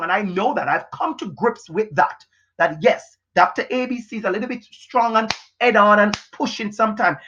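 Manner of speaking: ordinary talk, nothing marked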